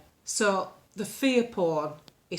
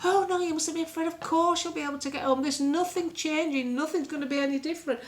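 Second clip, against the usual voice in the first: lightly voiced young woman, much higher and quick; a sweet reassuring operator, pitched up; ferry operator soothing a worried caller, higher and sweet; nice phone girl; 7 semitones higher than usual. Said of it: "she was so nice".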